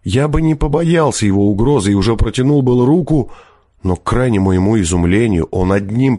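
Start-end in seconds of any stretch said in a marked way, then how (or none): none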